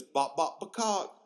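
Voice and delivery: clucking, jumping up high on the end; chicken cluck. Retold or imitated. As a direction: imitate a kid being a chicken. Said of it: "what does the chicken say?".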